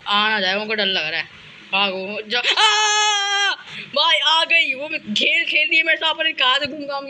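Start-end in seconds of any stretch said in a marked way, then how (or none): 2.2-3.6 very high drawn-out scream; startled scream. Real, not acted. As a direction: shriek in real fright. Caught by the ghost.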